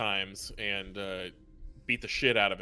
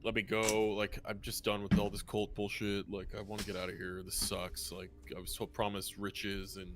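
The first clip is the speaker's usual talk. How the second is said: low-key, soft and resigned; dejected grumble; 3 semitones lower, 8 dB quieter than usual. Acted. Fed-up cultist complaining about his lot, soft and dejected. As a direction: grumble quietly, tired of it all.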